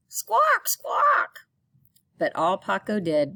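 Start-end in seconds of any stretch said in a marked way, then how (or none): 0.1-1.5 very high, loud parrot screech; loud parrot squawk. Acted.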